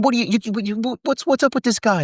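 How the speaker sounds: high, stammering mock indignation; Harvey Keitel going off. Actor complaining about his young co-star, stammering mock outrage. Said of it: "going off on me".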